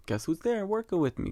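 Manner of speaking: ordinary talk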